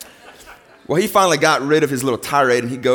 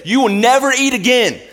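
much higher, louder hammed-up scolding; mock parent yell; 12 semitones higher, 6 dB louder than usual. Parent disciplining kids, yelling in mock anger. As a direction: bellow it like an overheated parent.

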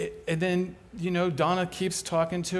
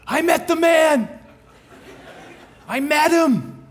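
much higher and louder, bursting with joy; excited news; 12 semitones higher, 9 dB louder than usual. Retold or imitated. Neighbor running up to the speaker, overjoyed and loud. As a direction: blurt it out, thrilled, laughing.

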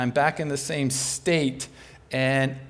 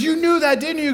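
much higher and louder, playful; teasing excitement; 12 semitones higher, 9 dB louder than usual. Retold or imitated. Neighbor teasing the speaker, high and excited. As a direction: tease him, grinning and loud.

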